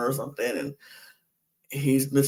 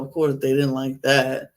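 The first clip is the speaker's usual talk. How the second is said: normal talking voice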